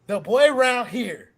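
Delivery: loud, slow hype verses; hyped poem reading. Acted. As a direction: perform it like slam poetry. Reads his poem aloud.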